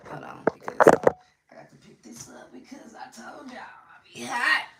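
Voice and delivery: voiceless whispered aside. Real, not acted. Moving the phone mid-video, a whispered aside to viewers. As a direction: whisper it off mic.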